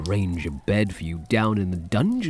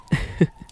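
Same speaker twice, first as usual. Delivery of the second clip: breathy little chuckle. Acted. Vampire amused by hunter.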